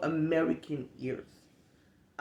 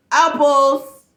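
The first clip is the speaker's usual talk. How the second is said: mock dumb answer; 8 semitones higher, 11 dB louder than usual. Absurd answer mocked.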